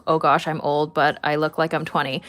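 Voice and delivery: ordinary delivery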